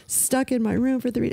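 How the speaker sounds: high, tearful whine; imitated bawling. Daughter in medical fearing quarantine, mock bawling. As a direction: mimic a daughter blubbering in protest. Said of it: "she starts bawling in the medical room".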